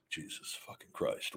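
exasperated whisper under the breath; real muttered whisper to himself; whispered curse. Real, not acted. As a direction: hiss it off-mic in disbelief. Exasperated aside reading spam.

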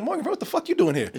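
quick incredulous mock question; mock baffled surprise. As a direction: imitate a confused viewer. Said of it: "No, it's more like".

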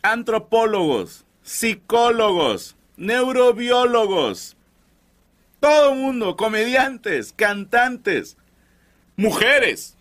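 drawn-out solemn listing; slow mock-epic roll call; slow dramatic build. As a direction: stretch each word like a grand intro. Slow dramatic list opening.

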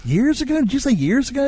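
surprised. Real, not acted. Incredulous repeat.

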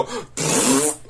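spluttering raspberry for a splatter; imitated spray noise. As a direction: blow out a messy splat sound. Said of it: "It's gonna just go".

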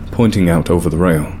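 ordinary delivery

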